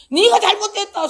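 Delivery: loud inner voice shouting, high. Retold or imitated. Pastor voicing the inner cry of rebuke, loud and high. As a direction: burst out as if a voice rises inside. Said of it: "소리가 안에서".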